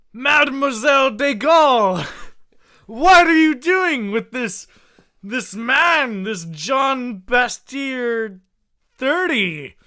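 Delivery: hammed-up jealous husband yelling; husband catches wife in alleyway, mock furious shout; mock outraged shout.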